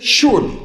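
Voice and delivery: SURELY pitched high and punched; emphatic assertion. Shown as a demonstration. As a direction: stress SURELY as if dismissing all doubt. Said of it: "When do we emphasize?".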